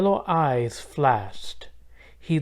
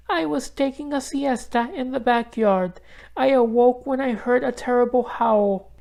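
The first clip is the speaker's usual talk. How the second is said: much higher, sweet grandma voice; high, gentle old lady greeting; kind grandmother; 11 semitones higher than usual. A narrator's reading. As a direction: greet her granddaughter gladly. Grandmother voice held.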